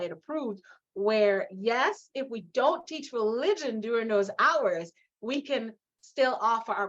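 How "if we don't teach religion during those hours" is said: ordinary delivery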